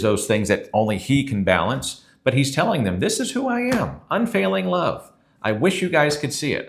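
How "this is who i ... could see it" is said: pleading, much higher, longing God; desperate plea. Retold or imitated. God pleading with his people through Moses, much higher and desperate. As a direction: reach up high as if begging to be understood. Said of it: "he's addressing them in the desperation in his voice".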